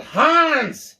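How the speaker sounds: loud, high yell of the villain's name. A narrator's reading. John yelling for the villain across the tower, a high loud yell. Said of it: "John yelled out".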